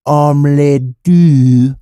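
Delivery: very slow, rolling French delivery; mock French accent. Showing how Dexter talks on the playground, slow mock French accent. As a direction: draw out each French word luxuriously. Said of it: "roll it off his tongue like a French guy".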